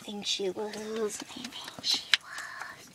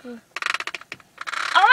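usual speaking voice

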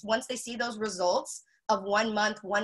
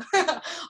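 a bright little laugh, higher; short real laugh; 6 semitones higher than usual. Real, not acted. Laughing at her own words to viewers. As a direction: laugh at your own slip.

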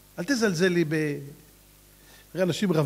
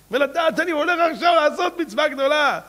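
higher, brighter excitement; joyful; 9 semitones higher, 5 dB louder than usual. Acted. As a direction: delight in the mitzvah. Joyful mitzvah thought.

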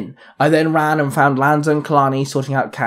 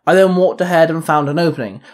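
usual speaking voice

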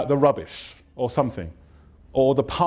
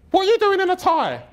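very high-pitched mimicked woman; hammed-up high female voice; nosy woman neighbour. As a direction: squawk it like a prying neighbour. Woman neighbour quizzing him.

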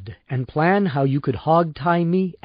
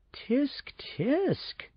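a high, soft, mocking tut-tut; mock tsk tsk; 10 semitones higher, 11 dB quieter than usual. Dan mocking Miles's threat, high soft tut-tut. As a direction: tut at him like a scolded child.

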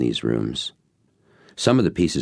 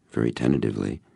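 usual speaking voice

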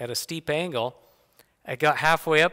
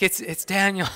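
higher, imitated breathless stammer; out of breath; 5 semitones higher than usual. Winded son reporting on his brother, mock breathless. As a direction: act winded, struggling to get words out. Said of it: "he's so out of breath".

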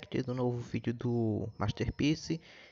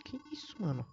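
shocked; 7 semitones higher, 8 dB quieter than usual. Real, not acted. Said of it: "shocked reaction".

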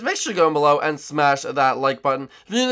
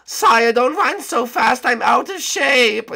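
high, loud whiny servant voice; whiny guard; 8 semitones higher, 4 dB louder, slower than usual. Acted. Woken by his captain, a high loud whiny guard. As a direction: whine up high at being woken.

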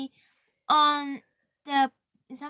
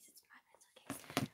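barely audible whisper; 19 dB quieter, faster than usual. Real, not acted. Child announcing her pencil case, in a whisper. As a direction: whisper it to yourself.

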